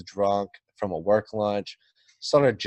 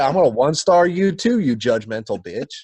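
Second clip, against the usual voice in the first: louder, higher taunting retort; mocking a rude passenger, louder; mock indignant comeback; 4 semitones higher, 6 dB louder than usual. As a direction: throw her threat right back at her, loud.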